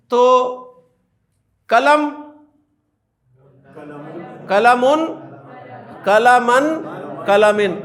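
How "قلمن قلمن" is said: قلمن said twice, ending un then in; case endings. Shown as a demonstration. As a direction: stress the shift in the last vowel of قلمن. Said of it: "اب دن دن کدھر گیا؟". (قلمن کے اعراب واضح ادا کرنا)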